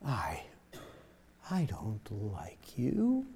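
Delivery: soft, slow whisper of a harsh line; sweet whispered tone over unkind words; gently. Retold or imitated. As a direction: coo the cruel words tenderly. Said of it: "and gently say".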